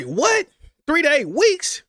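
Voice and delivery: high, incredulous questioning; shocked disbelief. Retold or imitated. Son hears his mom has only weeks, shocked. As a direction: repeat it back in shock, pitch leaping up. Shocked reaction to worse prognosis, retold.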